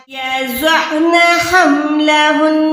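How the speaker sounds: یضعن with the عین from mid-throat and the نون held long; tajweed demo from the throat; throaty ain. Shown as a demonstration. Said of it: "عین کو حلق کے درمیان سے نکالیں".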